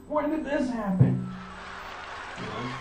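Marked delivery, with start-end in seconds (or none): 0.0-1.3 high, hammed-up shock; mock gasp of surprise. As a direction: fake astonishment.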